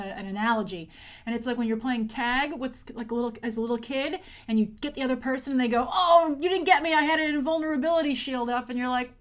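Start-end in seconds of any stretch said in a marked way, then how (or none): 5.9-8.7 put-on louder, higher childish protest; a kid weaseling out of being tagged; bratty kid. Imitating a kid playing tag, in a bratty higher whine.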